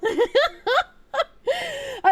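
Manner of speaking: high, very slow laughing words; laughing at the chat story. Real, not acted.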